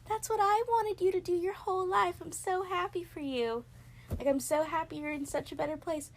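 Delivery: beam it out like a proud aunt; mock proud aunt. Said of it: "and I'm like".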